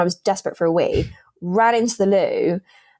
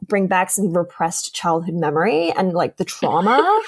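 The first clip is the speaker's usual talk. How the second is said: put-on American accent; 2 semitones higher than usual. Imitating her American date's accent for a laughing friend. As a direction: ham up an American guy musing about his food order. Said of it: "Please do it in an American accent".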